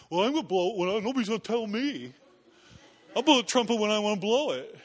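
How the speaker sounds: put-on voice of a stubborn soldier; defiant guy. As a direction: refuse orders in a mock-defiant voice. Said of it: "Suppose there's some guy going".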